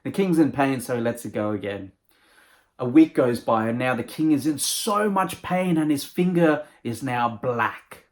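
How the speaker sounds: ordinary delivery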